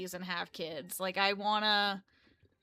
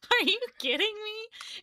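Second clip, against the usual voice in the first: much higher, incredulous; retold disbelief; 11 semitones higher than usual. Vlogger retelling her shock at the views, incredulous. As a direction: pitch it way up in disbelief. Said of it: "I was like".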